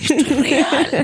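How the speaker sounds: real tears breaking through the words; crying laugh. Real, not acted. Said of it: "Me acuerdo y me pongo a llorar".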